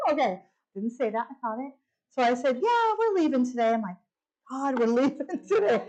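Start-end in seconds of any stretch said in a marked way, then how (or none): 2.6-3.7 high, chirpy confirmation; cheerful reply. Retold or imitated. Telling the desk lady they are leaving, chirpy. 4.5-5.8 higher, half-laughed exclamation; laughing relief. Retold or imitated. Private joy at leaving the hospital, laughingly relieved.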